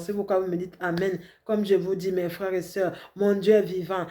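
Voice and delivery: ordinary talk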